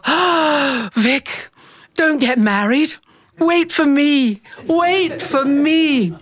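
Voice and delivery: slow, high, longing plea drawn out; dreamy pining. Retold or imitated. Girl silently begging her crush not to marry, pining. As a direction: sigh it like a lovestruck child.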